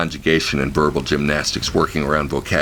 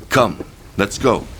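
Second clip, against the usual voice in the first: urgent woman; 6 semitones higher, slower than usual. A narrator's reading. Bian urging him away.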